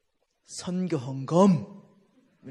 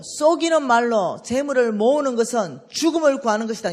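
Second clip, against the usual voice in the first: loud, higher startled recital of the verse; reading the verse in shock; 4 semitones higher, 10 dB louder than usual. Retold or imitated. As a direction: read it as if it shocks you. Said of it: "너무 놀래가지고".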